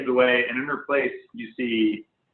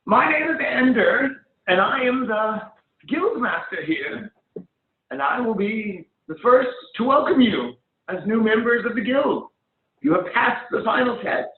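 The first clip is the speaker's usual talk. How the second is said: higher, proud guildmaster's welcome; 10 semitones higher than usual. Acted. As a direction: announce it like a formal ceremony. GM voices guildmaster Ender.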